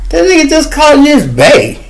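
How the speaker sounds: high, incredulous squeal; shocked disbelief. Retold or imitated. Telling how he reacted to his friend's slip, squealing. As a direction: retell the outrage in a high squeal. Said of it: "I said".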